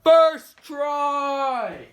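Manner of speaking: loud high triumphant shout; excited shout. Real, not acted. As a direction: cheer the win. Trick landed first attempt.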